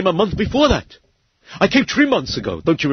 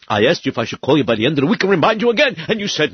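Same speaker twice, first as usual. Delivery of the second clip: ordinary delivery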